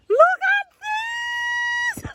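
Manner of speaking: very high and stretched, slow; real overjoyed shriek; drawn-out excited squeal. Real, not acted. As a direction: hold the word out in amazement. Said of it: "sorry to be shouting in your ears".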